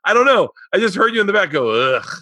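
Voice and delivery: high, loud defensive explanation; flustered dad. Retold or imitated. Dad defending himself to his daughter, high and loud. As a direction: justify yourself, laughing and flustered. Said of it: "And I was like".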